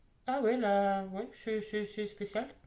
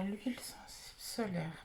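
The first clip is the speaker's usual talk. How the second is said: whispered label reading; 3 semitones lower, 10 dB quieter than usual. Real, not acted. Reading the sunscreen label to herself, whisper. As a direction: whisper the product name as you read it.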